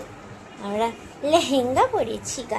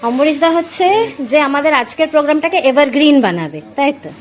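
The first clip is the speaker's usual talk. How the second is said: excited introduction; 7 semitones higher, 12 dB louder than usual. Real, not acted. Loudly introducing the photographer.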